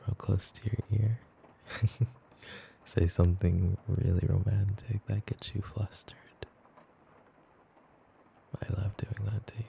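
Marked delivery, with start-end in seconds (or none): 2.9-9.6 intimate whisper. Acted. Girlfriend cuddled up teasing her partner, whispering. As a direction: close ASMR whisper in the ear. ASMR girlfriend close to ear.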